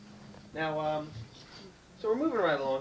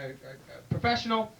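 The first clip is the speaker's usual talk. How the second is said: ordinary delivery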